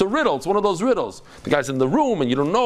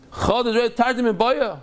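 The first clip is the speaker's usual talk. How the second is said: higher, indignant repeat; mad questioning; 6 semitones higher than usual. Acted. Rabbi reacting to a needless question, mad. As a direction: throw it back higher, as if annoyed. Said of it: "He would be very mad".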